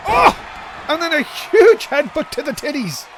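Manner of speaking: big yelp at the headbutt; excited shout. Real, not acted. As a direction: shout it ringside. Live wrestling commentary.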